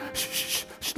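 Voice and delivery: repeated sharp "shh"; quick string of shushes; urgent shushing. Retold or imitated. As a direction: hush the crowd so they can hear. Hushing the others to listen.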